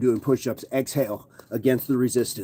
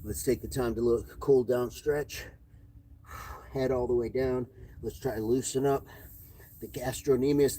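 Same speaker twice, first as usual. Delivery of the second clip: soft, easy stretching guide; calm cool-down; 7 dB quieter than usual. Acted. Coach guiding viewers through a stretch, soothing. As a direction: ease them down gently.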